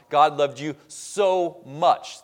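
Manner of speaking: "so" leaned on and set apart before "much"; stress on SO MUCH. Shown as a demonstration. Preacher showing the common reading of the verse, stress on "so much". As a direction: stretch "so" to mean a great quantity. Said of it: "the emphasis is on".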